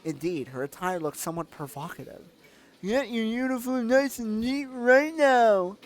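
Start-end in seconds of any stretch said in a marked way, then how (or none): 2.8-5.8 high, slow scolding voice; a hint of a stern leader; stern camp leader voice. Olga scolds Alyssa.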